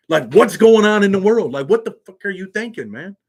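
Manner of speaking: loud, high-pitched exasperation; outraged outburst. Real, not acted. Vlogger reacting to little kids getting shot, outraged outburst. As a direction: burst out in real disbelief at the senselessness.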